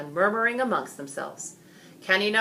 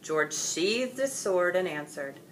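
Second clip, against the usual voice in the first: ordinary delivery, nothing marked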